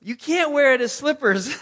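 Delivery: high, loud exasperated scolding; imitated exasperated parent; Michelle. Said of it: "I just hear Michelle".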